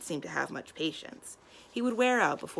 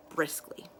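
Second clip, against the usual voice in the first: ordinary delivery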